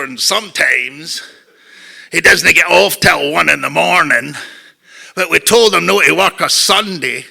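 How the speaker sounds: loud Scottish father; imitating a blustering Scottish dad; dad's Scottish accent. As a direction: hold forth in a thick Scottish burr. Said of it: "that's my dad".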